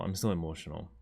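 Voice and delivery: quietly emotional. Real, not acted. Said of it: "I'm still emotional though".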